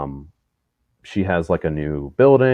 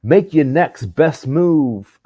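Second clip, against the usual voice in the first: high, very slow drawn-out impression; 8 semitones higher, much slower than usual. Quoting a celebrity's posted advice to friends, slow high impression. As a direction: stretch each word in a high mock-motivational voice. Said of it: "He was like".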